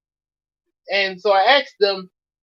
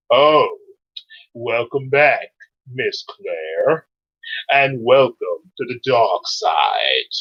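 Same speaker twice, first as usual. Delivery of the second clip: Darth Vader; 6 semitones lower, slower than usual. Pretend. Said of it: "Darth Vader".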